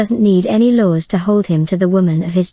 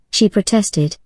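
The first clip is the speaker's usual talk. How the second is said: ordinary delivery, nothing marked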